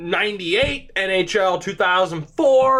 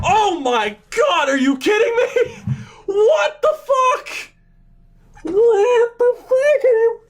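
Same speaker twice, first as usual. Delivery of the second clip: high-pitched squeal of disbelief; shocked outburst; 12 semitones higher than usual. Real, not acted. Streamer stunned by a draft pick, high shocked squeal. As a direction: cry out, pitch leaping way up.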